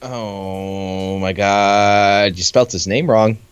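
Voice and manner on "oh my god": normal talking voice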